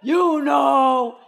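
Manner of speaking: very high, loud wailing prayer; hammed-up wail to the Lord; dramatic praying mom. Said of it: "it was that dramatic".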